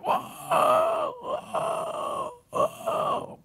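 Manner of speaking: very high, thin mock wailing; imitated cries of pain. Troublemaker suddenly cries out during the hymn, high mock wailing. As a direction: mimic someone suddenly howling, high and strained. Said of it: "tiba-tiba ada orang teriak".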